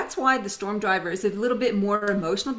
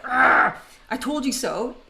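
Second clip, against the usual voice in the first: loud mock growl, then higher-pitched retort; growl, then act out an exasperated complaint; frustrated growl; 4 semitones higher, 5 dB louder than usual. Someone at a leadership table, acted frustrated outburst. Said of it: "someone's like".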